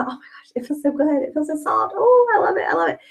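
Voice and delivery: hushed start rising to high gushing; acted delight. Customer feeling her freshly treated face, delighted. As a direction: swoon over it in a high, light voice.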